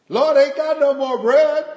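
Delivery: put-on high, loud child's voice; little boy shouting. Boy in the belfry tells preacher, shouting down. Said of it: "the little boy looked down".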